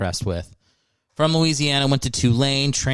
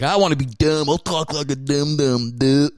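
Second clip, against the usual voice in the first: raised-pitch hack dimwit impression; dumb Southern caricature; 4 semitones higher than usual. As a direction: do a hammy late-night dumb-guy bit. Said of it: "every late night show's like".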